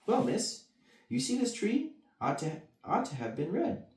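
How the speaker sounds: high nervous card voices; gardeners. Acted. Gardeners explain to Alice, high anxious. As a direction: explain anxiously to the young lady. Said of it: "they answered".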